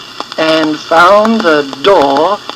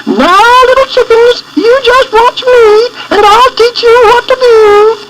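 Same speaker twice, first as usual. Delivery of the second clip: high, clucky mother hen voice; a fussy hen teaching her chicks; mother hen talk; 4 dB louder than usual. A narrator's reading. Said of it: "sounded just like chicken talk".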